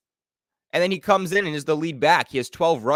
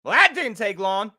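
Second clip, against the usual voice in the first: exasperated; 12 semitones higher than usual. Real, not acted. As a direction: groan at how fast it fell apart. Reacting to the interception.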